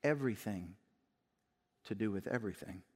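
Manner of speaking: soft, low, tender father's answer; father lovingly answering his jealous son. Acted. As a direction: gently reassure your hurt son. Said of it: "the father could lovingly respond".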